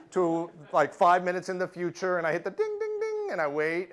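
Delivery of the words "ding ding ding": high, ringing "ding" noises; imitated machine dings. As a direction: mimic a machine chiming.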